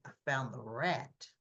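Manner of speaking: hint of a cold gangster; menacing mob boss. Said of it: "He goes".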